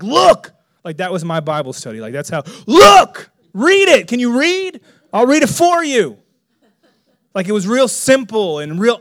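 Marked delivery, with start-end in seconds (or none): 0.0-0.5 loud, high-pitched "look"; insistent exclamation. Retold or imitated. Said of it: "I just read the Bible and was like". 2.7-6.2 loud, high, punchy urging; insistent exclamation. Retold or imitated. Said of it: "I just read the Bible and was like".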